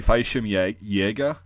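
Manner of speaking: Fallschirmjäger in German, ending YAY-gah; actual German pronunciation. Shown as a demonstration. Correcting viewers with the actual German, Fallschirmjäger ending YAY-gah. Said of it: "it's actually".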